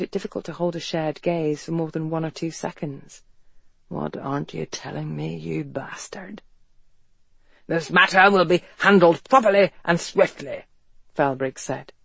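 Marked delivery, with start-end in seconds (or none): 3.9-6.4 whispered thought. A narrator's reading. Ethan's suspicious private thought about Felbrigg. 7.7-10.6 put-on police chief's voice; self-important official character voice; officious commissioner. A narrator's reading.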